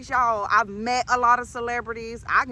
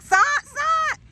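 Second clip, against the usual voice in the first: high-pitched, squeaky double take; high incredulous echo of the word; stunned disbelief; 13 semitones higher than usual. Retold or imitated. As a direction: shriek the repeat in disbelief. Stunned by ex's news.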